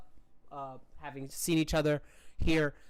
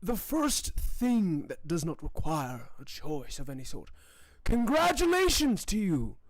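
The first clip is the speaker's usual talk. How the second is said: laboured ruler's address, swelling at the end; ailing Gustavo. Acted. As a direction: make a halting royal speech from a sickbed. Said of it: "and he goes".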